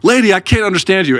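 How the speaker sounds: hammed-up bartender brushing her off; annoyed bartender. Bartender telling the wife he can't understand her. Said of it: "He'd be like".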